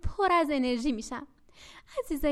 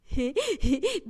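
sly chuckle; 4 dB louder than usual. Acted. Sly bag begins speaking.

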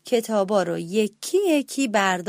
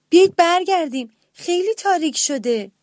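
worried call; 7 semitones higher, 4 dB louder than usual. A narrator's reading. Brother alarmed at the dark.

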